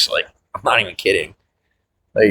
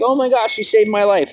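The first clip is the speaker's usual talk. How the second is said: mock grateful gush; 10 semitones higher than usual. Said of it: "People be like".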